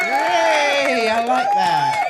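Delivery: high, loud happy shout; excited cheer. Real, not acted. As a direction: cheer it out with delight. Cheering the subscriber milestone.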